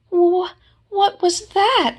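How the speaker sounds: high, slow frightened stammer; scared squeak. Acted. Piglet startled by a noise, frightened high stammer. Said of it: "asked Piglet".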